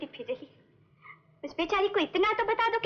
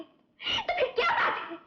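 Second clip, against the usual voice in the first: "तो फिर क्या बात है" high, slow and tearful; wailing demand; 10 semitones higher, much slower than usual. Acted. Sister begs "तो फिर क्या बात है" of brother, wailing. Sister repeats, breaking into wail.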